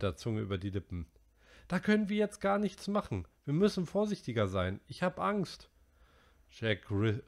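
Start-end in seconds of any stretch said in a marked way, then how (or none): 1.7-5.7 put-on high, worried boy's voice. A narrator's reading. Narrator voicing Piggy to the boys, high and worried. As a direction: voice the anxious fat boy.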